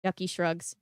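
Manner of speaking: usual speaking voice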